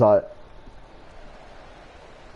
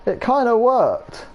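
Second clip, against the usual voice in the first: slow goofy voice; 8 semitones higher, slower than usual. Retold or imitated. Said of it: "He's like".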